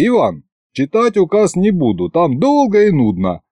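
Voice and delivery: plain reading voice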